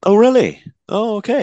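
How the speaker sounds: high, loud burst of surprise; real surprise. Real, not acted. Co-host surprised by Live and Let Die at number four, high and loud. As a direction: caught off guard, pitch jumps way up.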